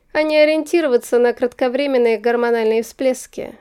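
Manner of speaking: confident aristocratic suitor; lordly lecturing voice; Persival. Acted. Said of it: "продолжил лорд Туаретонг".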